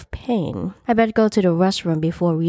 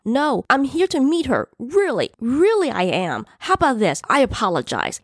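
high, insistent protest; shouted plea; 7 semitones higher than usual. A narrator's reading. Man to store owner, shouted apology. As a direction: plead across the room, pitch up. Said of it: "he shouted loudly".

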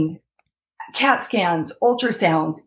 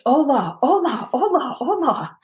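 high, calling hola across the ward; 7 semitones higher than usual. Retold or imitated. In the ER calling for a nurse, high calling out. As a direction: call for help, pitched up. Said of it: "yelling it".

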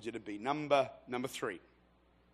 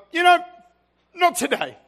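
high, loud mock refusal; hammed-up grouchy dismissal; grumpy old dude; 16 dB louder than usual. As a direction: brush them off like a cranky God. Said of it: "He's like".

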